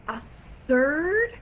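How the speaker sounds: loud, high disbelieving question; astonished outburst, acted out; incredulous. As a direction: exclaim it in shock, pitch shooting up. Retold shock at a third mess.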